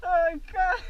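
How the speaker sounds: pained, tearful cry; hurting after the crash. Real, not acted. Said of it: "Deu dor". (choramingando de dor)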